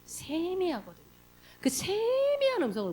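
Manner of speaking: hushed and delicate; still small voice. Shown as a demonstration. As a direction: draw it out faintly, barely there. Illustrates God's still small voice.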